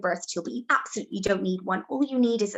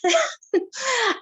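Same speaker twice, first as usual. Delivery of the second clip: short laugh; 4 dB louder than usual. Real, not acted. Laughs at herself.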